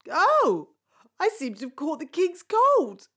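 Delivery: normal talking voice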